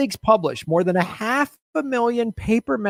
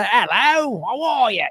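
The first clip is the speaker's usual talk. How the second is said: raised, exaggerated Cockney greeting; Cockney accent; 5 semitones higher than usual. Shown as a demonstration. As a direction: sell the bad Cockney accent. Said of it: "those Cockney folks with their shoulders".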